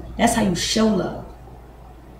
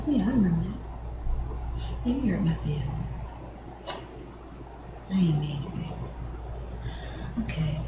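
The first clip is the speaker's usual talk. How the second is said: soft, hushed pillow talk with her man; 2 semitones lower, 8 dB quieter than usual. Pretend. A woman softly talks through hurt feelings with her man, hushed. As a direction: coax him gently, nearly whispering.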